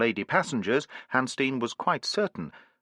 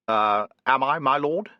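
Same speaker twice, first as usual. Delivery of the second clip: uncertainly; 3 semitones higher, 4 dB louder than usual. A narrator's reading. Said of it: "said Hanstein uncertainly".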